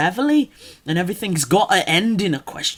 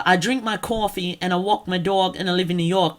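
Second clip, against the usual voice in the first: rounded New York vowels; New York accent; faster than usual. Shown as a demonstration. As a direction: lean on the "aw" in New York style. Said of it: "everyone can do a good".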